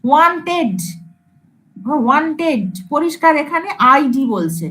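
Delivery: usual speaking voice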